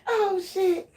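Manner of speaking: high, tearful laughter; laughing to tears; helpless laughing. Real, not acted. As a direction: barely get it out through real laughter. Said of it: "this shit making me cry".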